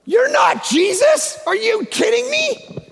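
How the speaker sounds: forceful pulpit shout; preaching shout. Real, not acted. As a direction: drive it home at full volume.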